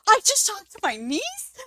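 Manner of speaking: high, loud, astonished question; excited brother. Retold or imitated. Brother learning he spoke to his niece, excitedly. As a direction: retell the brother's thrilled disbelief. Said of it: "He got all excited".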